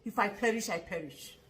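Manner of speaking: quoting Esther very softly, trailing almost to nothing; hushed quote. Retold or imitated. Commentator quoting Esther to shame a journalist, very soft. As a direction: drop right down and breathe the words. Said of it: "Esther said".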